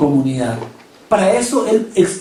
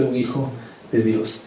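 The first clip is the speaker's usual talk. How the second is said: hushed ending; 7 semitones lower, 10 dB quieter than usual. Acted. Solemn close of the point.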